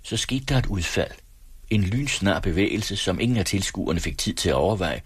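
usual speaking voice

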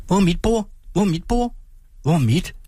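put-on high eager children calling; children's chorus. A narrator's reading. Children at the tree asking for their tables, high and eager. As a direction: pitch it up like excited kids. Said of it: "lød børnenes kor".

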